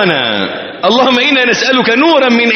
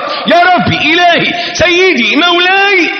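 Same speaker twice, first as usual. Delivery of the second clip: fervent calling out; 11 semitones higher, slower than usual. Acted. Voicing a worshipper calling God.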